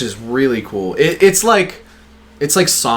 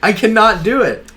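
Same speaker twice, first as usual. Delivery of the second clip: exasperated; 9 semitones higher, 3 dB louder than usual. Real, not acted. Frustrated at failing Australian accent.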